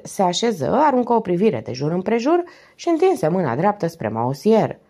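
ordinary delivery

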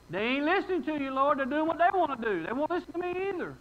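high-pitched tattling protest; mock complaining. Preacher as Elijah blaming church people, high mock complaint. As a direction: whine to God about everyone else.

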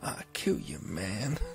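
low, soft, breathy muttered threat. Retold or imitated. Co-host imitates Brad Pitt's stoned muttered threat, low and soft. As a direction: grumble it under the breath. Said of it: "mutters to himself under".

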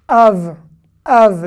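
Av said loud and drawn out, slowly; model pronunciation. Shown as a demonstration. Teacher drilling Av, the word for father. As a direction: stretch out Av for the learners. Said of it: "teacher models the word".

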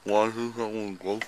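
slurred numb mouth; words mushy from anesthesia; numb from the dentist. Real, not acted. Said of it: "I can't move my mouth".

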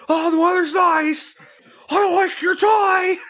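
high, loud fake-polite small talk; mock small talk. Enraged small talk acted out, loud and high. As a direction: shout pleasantries with forced cheer. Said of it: "engaged and enraged small talk".

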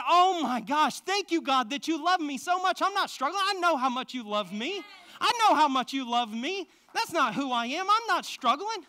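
loud, high, triumphant praise; a man thanking God aloud in triumph; joyful declaration. Retold or imitated. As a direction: shout the victory out to God. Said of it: "And I said".

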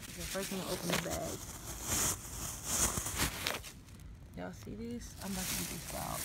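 whispering the prank plan; hushed so she can't hear. Real, not acted. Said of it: "hushed prank setup to camera".

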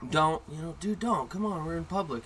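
mock pleading friend; imitated pleading friends. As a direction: plead with a buddy to stop. Friends pleading with loud kid.

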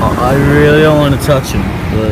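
loud distant call; shouting from afar. Real, not acted. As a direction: yell it across the beach. Shouting from far off.

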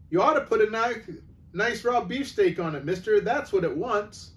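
high, loud cheeky lad; butcher's boy shouting advice at the shopkeeper. Acted.

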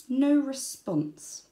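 very slow No response; slow flat letdown. A narrator's reading. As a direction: drag it out, deadpan. Wombat let down.

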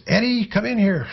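high-pitched mock holler; Colonel's imitated yell. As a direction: mimic a boss calling out, pitch leaping way up. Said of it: "yelled out in his loud voice".

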